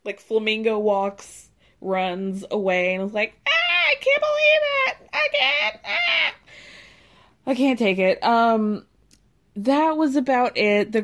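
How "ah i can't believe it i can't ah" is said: high, loud, hammed-up wail of disbelief; loud theatrical cry as Lisa sees the same dress; mock shriek. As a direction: imitate a dramatic shriek while running off. Lisa runs away shrieking.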